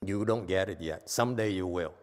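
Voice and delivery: ordinary talk, nothing marked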